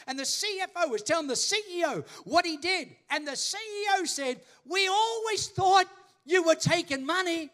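high, loud, driving pulpit voice; full-force preaching. Real, not acted. Preacher brings testimony to its climax, full-force preaching. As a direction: build from story to ringing declaration.

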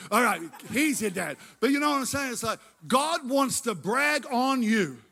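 normal talking voice

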